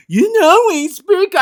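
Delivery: high, mocking fan voice. Mocking sprint car fans, in a high mock voice. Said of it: "I hear these people".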